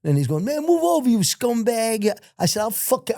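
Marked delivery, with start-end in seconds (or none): 0.4-2.2 high mock heckle, drawn out at the end; retold yelling. Inmate heckling a man at a visit, high mock yell. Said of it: "they're saying and yelling it". 2.6-3.2 retold screaming. Prisoner hollering backwards at hecklers, high mock scream. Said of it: "I'm screaming backwards".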